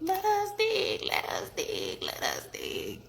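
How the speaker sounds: thin, squeaky wee frog voice; small pinched peeping echo; little frog peep. A narrator's reading. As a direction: squeak it like tiny frogs. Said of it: "the little frogs peeped".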